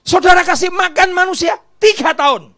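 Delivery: loud, high declaration; thundering pulpit call; booming preach. Acted. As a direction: boom it out to the congregation. Preacher booming opening line.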